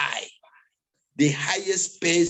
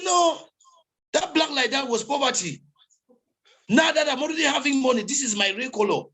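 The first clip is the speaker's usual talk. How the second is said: higher, quick, lightly voiced boast; rich man's retort; 7 semitones higher than usual. A hint of the rich man answering gossipers at the event. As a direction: toss back the answer proudly.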